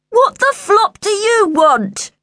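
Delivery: high, slow, drawn-out yell; irritated high-pitched holler; rude shout. A narrator's reading. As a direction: shout it rudely through a door. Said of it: "Luke shouted rudely".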